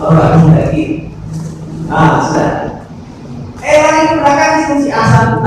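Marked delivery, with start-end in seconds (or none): 3.6-5.5 mock alarm. Alarmed father chasing child.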